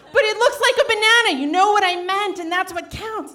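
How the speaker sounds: high, loud, half-laughing yell; hammed-up shrieking argument; laughing mock scream. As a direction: scream your point, cracking up. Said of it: "this screaming ... crazy pants fight".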